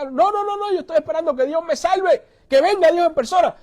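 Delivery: high-pitched, insistent waving away of help; stubborn refusal. Acted. Drowning man turns down the speedboat, stubbornly insistent.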